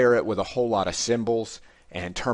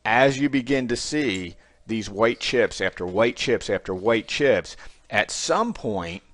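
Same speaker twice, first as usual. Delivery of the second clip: slow build-up; 2 semitones higher, slower than usual. Shown as a demonstration.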